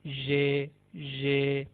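"ये" with a zh sound as in pleasure; Turkish J sound. Shown as a demonstration. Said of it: "प्लेजर के अंदर एसयू को". (प्लेजर जैसी ज़्ह आवाज़)

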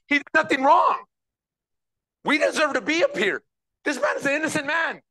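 a pleading, shouted cry from the cross; high, urgent preacher-voiced thief; dying thief defending Jesus. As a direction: cry out for the innocent man. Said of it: "defending Jesus against other thief and said".

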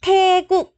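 high, drawn-out "Tae" in "Tae Quốc". Shown as a demonstration. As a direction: lift "Tae" well up, then drop on "Quốc". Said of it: "lên giọng xíu ha".

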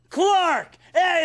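ordinary delivery

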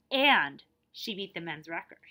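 ordinary delivery, nothing marked

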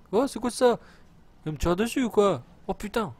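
high, loud burst of alarm; real startled protest, pitch leaping up; startled outcry. Real, not acted. Player finds units firing on him, startled. Suddenly fired on in game.

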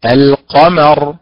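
القمر drawn out slowly, each sound clear; slow model reading. Shown as a demonstration. Teacher models القمر slowly for the class. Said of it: "طيب،".

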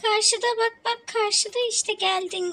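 high, loud excited calling; excited shout. Real, not acted. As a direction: cheer the character on loudly. Excited game moment calling out. (heyecanlı bağırış)

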